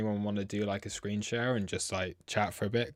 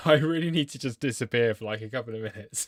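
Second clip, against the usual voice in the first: needing the toilet; 6 semitones higher, 9 dB louder than usual. Real, not acted. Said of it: "otherwise I'm gonna wet myself".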